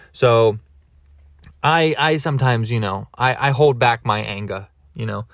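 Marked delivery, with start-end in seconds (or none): none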